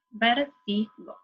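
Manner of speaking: VÉRTIGO slowly broken as vér, ti, go; split into syllables. Shown as a demonstration. Teacher separating a word in three, VÉRTIGO slowly syllable by syllable. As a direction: say VÉRTIGO one syllable at a time with gaps. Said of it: "se separa en tres sílabas".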